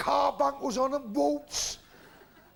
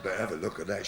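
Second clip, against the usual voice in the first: much lower voice, eager; low leering vicar; 4 dB quieter, much faster than usual. Acted. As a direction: drop low and leer it out.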